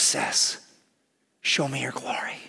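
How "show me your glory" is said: hushed, breathy whisper of longing; whispered plea. Retold or imitated. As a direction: whisper it to God like a secret prayer. Moses pleading with God.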